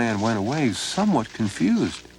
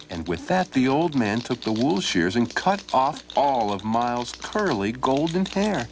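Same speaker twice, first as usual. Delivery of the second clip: ordinary delivery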